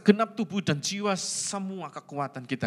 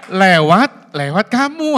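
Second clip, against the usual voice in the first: loud emphatic retelling; 11 dB louder, slower than usual. Retold or imitated. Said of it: "aku bilang gitu".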